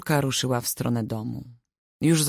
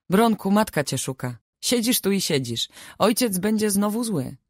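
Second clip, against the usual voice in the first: reproachful; 7 semitones higher than usual. A narrator's reading. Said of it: "rzuciła z pretensją w głosie".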